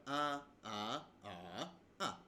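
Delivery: ah flat, then rising, then dipping, then falling sharply; ah sung through the four Mandarin tones; four tones in a row. Shown as a demonstration. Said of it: "are the four tones".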